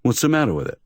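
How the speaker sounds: high, mildly offended; a touch of wounded pride; injured tone. Acted. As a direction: defend yourself, a little hurt. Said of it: "in an injured tone".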